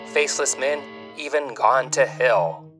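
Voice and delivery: normal talking voice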